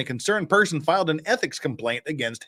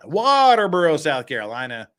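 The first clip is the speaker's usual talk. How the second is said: usual speaking voice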